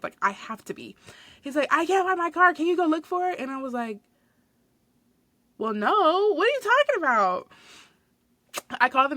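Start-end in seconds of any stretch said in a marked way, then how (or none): 1.7-3.4 higher, louder mock plea; customer's plea. Quoting the lost customer. 5.6-7.5 high, loud disbelief; incredulous. Retold or imitated. Incredulous reply to customer.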